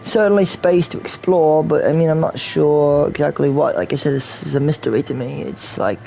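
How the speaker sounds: usual speaking voice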